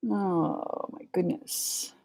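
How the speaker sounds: slow, breathy whisper to herself; whispered aside. Real, not acted. Reader reacting to a card under her breath, whispered. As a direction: murmur it under your breath, slowly.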